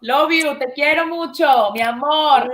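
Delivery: loud, loving goodbye; effusive farewell. Real, not acted. Guest signing off to her host friend, loud effusive affection. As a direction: call out your affection at full voice.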